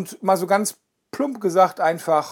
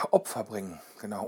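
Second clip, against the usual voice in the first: ordinary talk, nothing marked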